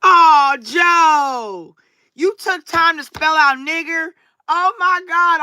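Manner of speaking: high, mock-thrilled gushing at the troll; sarcastic thanks pitched high. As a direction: fake delight, overdone and high. Mock gratitude toward troll Joe.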